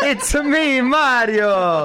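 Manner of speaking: high, hammed-up Italian accent. Host echoes co-host's joke, high mock Italian accent. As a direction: exclaim it in a cartoon Italian accent.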